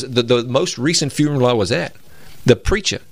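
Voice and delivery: ordinary talk, nothing marked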